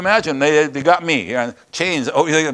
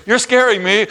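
mock scared; 9 semitones higher, 6 dB louder than usual. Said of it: "this person goes".

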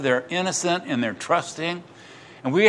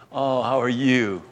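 put-on slow, gentle coo to a child; tender greeting; 3 semitones lower, 4 dB quieter than usual. Jesus greeting children one at a time, tender. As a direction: imitate a fond, unhurried hello.

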